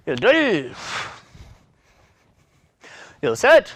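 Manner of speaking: loud, punchy count of each rep in Korean; 한 번씩 힘차게 외치는 구령; counting calls. Real, not acted. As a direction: bark out every number to drive the set. Instructor counting out kicks.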